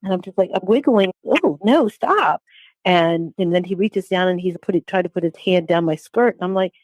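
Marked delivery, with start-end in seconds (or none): 1.2-2.4 raised-pitch plea to stop; recalled objection, pitch lifting on the stop; retold protest. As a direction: imitate your own alarmed refusal. Retold protest while being touched.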